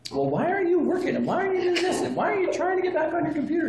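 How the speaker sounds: high, whiny nagging voice, mimicked; high mock-nag of a bickering clerk; mocking bickering coworker. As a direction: parody a coworker picking at another. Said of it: "bickering with the first lady".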